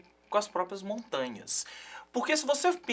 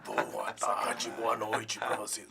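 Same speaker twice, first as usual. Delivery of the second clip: deep rasping growl; 9 semitones lower, 7 dB quieter, much slower than usual. Acted. Opening the show in growl voice.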